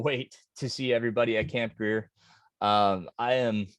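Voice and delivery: normal talking voice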